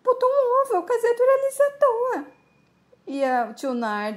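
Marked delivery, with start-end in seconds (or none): 0.0-2.2 high wailing lament. Acted. Mirna desesperada com o ovo.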